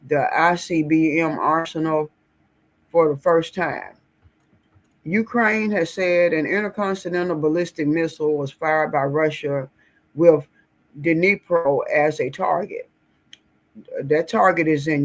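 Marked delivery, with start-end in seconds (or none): none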